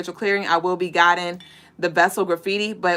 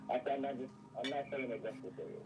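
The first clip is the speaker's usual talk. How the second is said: muffled remote voice; 6 semitones lower, 22 dB quieter, faster than usual. Real, not acted. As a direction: voice muffled by headphones. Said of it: "You sound muffled".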